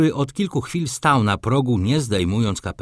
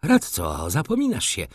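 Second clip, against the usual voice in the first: ordinary delivery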